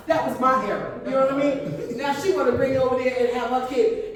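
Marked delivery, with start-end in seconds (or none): none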